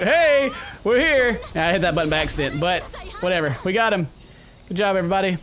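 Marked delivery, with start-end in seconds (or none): 0.0-1.4 drawn-out, higher cheery hey; horses announcing themselves. Retold or imitated. Horses appearing out of nowhere to the player, mock announcement. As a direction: voice the horses popping up, slowly. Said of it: "They were like".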